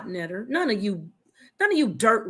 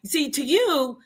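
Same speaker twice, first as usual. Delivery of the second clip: ordinary delivery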